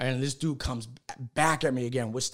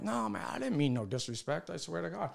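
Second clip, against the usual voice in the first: quiet pleading; 3 semitones higher, 10 dB quieter than usual. Retold or imitated. Pleading before fight.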